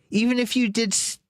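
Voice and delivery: high Bilbo-like voice used for the dragon by mistake; wrong voice. Shown as a demonstration. Reader slips into the hobbit's high voice for the dragon. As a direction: slip into the wrong character's voice, pitched high. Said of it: "Wrong voice there".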